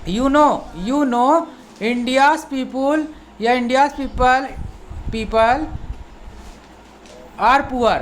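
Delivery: very slow, word by word model reading; teacher reads the sentence slowly for students. Shown as a demonstration. Teacher models the English sentence slowly for the class. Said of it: "sentence बना करके देखते हैं".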